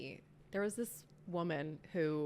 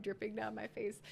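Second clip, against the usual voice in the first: ordinary talk, nothing marked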